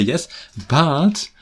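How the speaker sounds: hammed-up student urging go on; imitating a Japanese student nudging me to continue. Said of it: "then they say".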